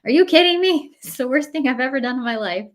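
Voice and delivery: high, loud, fast indignant protest; outraged kid. Retold or imitated. Kids to mom, high outraged whine. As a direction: act the disgusted kid who's had enough. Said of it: "They're like".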